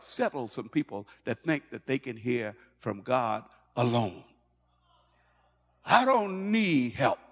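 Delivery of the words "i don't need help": high, drawn-out mock defiance; self-sufficient boasting. Voicing people who think they hear God alone, defiant. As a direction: play a stubborn loner, slow and high.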